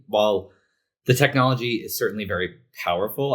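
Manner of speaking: usual speaking voice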